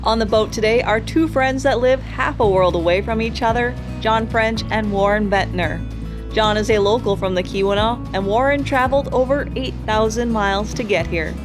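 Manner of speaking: normal talking voice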